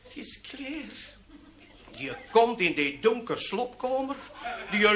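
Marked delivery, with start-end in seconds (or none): 0.0-1.1 high, soft, drawn-out call; lightly acted bride calling to her husband; bride calling softly. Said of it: "En ze roept". (zacht en hoog roepend bruidje)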